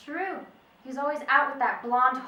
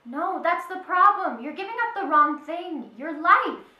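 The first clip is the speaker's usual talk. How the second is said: raised, higher, louder rebuke; angry shouting; 6 semitones higher, 8 dB louder than usual. Acted. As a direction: shout it at him. Widow scolds detective in argument.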